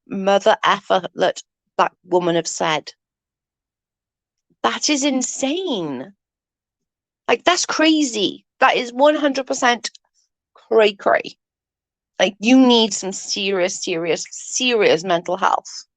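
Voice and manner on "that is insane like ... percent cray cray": incredulous. Real, not acted. Incredulous at the transcripts.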